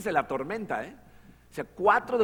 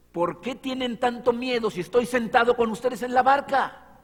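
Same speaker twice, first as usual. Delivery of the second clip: loud challenge; 8 semitones higher, 6 dB louder than usual. Retold or imitated. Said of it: "Lo que no entiendo es".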